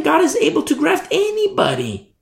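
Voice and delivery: soaring preacher repeat; high ringing emphasis. Acted.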